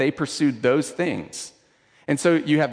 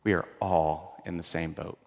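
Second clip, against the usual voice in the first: hushed repeat; 8 semitones lower, 8 dB quieter than usual. Shown as a demonstration. Preacher to the congregation, softly sinking. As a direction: drop it much softer and lower, almost to a murmur. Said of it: "repeats the line twice".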